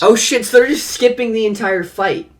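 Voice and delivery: high, loud disbelief; surprised outburst. Real, not acted. Co-host hearing the story skip the fight, surprised outburst. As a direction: burst out, voice jumping right up.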